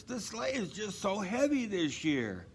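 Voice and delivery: a tired horse complaining gently; the horse's voice. A narrator's reading. The horse telling Santa the load is heavy, weary. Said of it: "said the horse".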